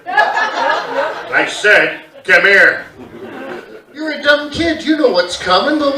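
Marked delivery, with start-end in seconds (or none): 0.0-2.8 dad calling. Retold or imitated. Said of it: "he'd go".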